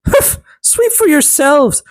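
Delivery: high, slow scornful huff. A narrator's reading. Stepsister scorning the elves, high and scornful. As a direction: sniff it away with contempt. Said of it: "she answered".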